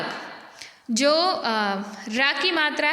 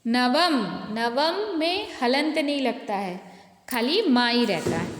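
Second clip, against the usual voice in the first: ordinary talk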